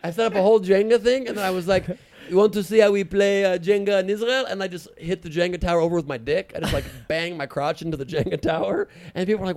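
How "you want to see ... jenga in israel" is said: high, loud put-on Israeli accent; hammed-up Israeli guy, high and loud; Israeli character voice. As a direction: show off the Israeli way to play. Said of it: "in character".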